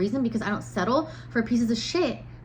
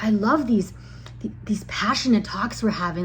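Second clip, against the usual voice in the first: lightly mimicking a creepy man; a hint of a freaky, syrupy suitor; mock creepy sweet talk. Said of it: "his tone of voice is really freaky".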